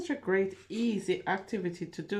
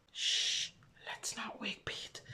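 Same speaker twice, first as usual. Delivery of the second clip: softer whispered hush; 10 dB quieter than usual. Acted. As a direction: whisper so you don't wake the sleeper. Hushing so Pete can sleep.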